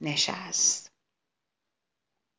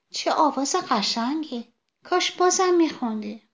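much higher, delighted little flower; delighted sunflower; 7 semitones higher than usual. Acted. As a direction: gush over the song.

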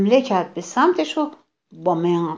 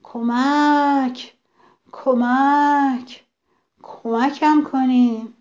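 slow, drawn-out desperate call, higher and a touch louder; a trapped deer calling for help, long and pleading; cry for help; 5 semitones higher, 4 dB louder, much slower than usual. A narrator's reading. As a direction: stretch each plea for help out as far as it goes. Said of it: "فریاد کشید".

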